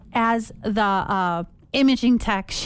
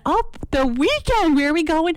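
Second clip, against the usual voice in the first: mock chirpy question; 7 semitones higher than usual.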